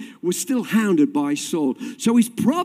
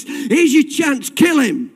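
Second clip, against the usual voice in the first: acted urging; 8 semitones higher, 5 dB louder, slower than usual. Men urge David, repeated more intensely.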